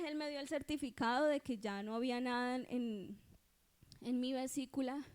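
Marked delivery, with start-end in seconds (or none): none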